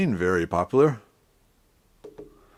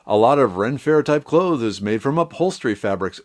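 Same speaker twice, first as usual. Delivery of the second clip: usual speaking voice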